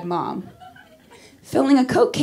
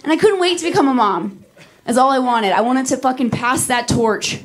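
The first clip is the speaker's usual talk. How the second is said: ordinary delivery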